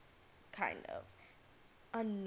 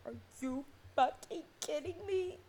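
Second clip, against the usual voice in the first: high, incredulous outburst; high-pitched exasperated quote; retold exasperated disbelief; 7 semitones higher than usual. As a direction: act out being totally fed up. Acts out disbelief at his reply.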